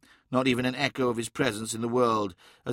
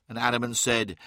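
ordinary delivery

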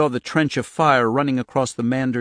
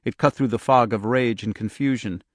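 ordinary delivery, nothing marked

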